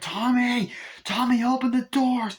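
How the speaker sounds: high, urgent calling; hammed-up panicked demand; mock frantic shouting. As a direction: imitate yelling to be let in. Victim yells to be let in.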